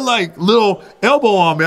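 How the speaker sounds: normal talking voice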